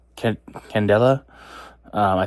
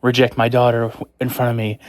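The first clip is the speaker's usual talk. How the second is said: angry king; 2 semitones higher than usual. Retold or imitated. King scolding Brooks for rejecting his daughter, mock outrage. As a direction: scold like an offended father. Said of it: "And then he's, like, s-, angry".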